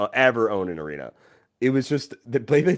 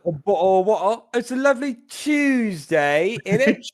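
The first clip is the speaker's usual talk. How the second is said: bad British accent; 8 semitones higher than usual. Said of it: "a bad accent from a different country".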